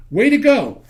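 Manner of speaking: sarcastic praise for a botched radiator job; sarcastic cheer. Real, not acted. Sarcastic praise of bad work.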